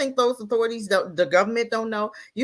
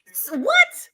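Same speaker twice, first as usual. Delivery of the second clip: incredulous what; 13 semitones higher, 5 dB louder than usual. Real, not acted. Outraged disbelief at beach ban.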